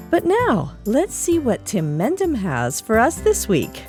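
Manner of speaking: usual speaking voice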